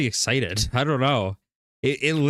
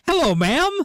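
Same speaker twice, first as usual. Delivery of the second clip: high-pitched excited greeting; 4 dB louder than usual. Retold or imitated. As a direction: imitate a thrilled drive-through customer. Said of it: "very excitedly goes".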